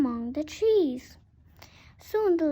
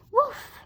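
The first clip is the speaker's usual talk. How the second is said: dog woof; 9 semitones higher than usual. Acted. Dog barks in story.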